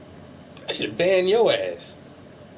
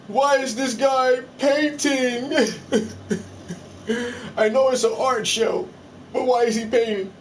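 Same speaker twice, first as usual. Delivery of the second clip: mock viewer; 6 semitones higher than usual. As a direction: play the baffled viewer. Mimics confused viewer.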